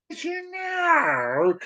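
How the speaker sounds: high drawn-out title call; hammed-up announcer. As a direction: croon the title like a show host.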